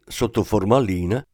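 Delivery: plain reading voice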